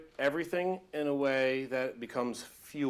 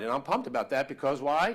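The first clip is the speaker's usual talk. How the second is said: usual speaking voice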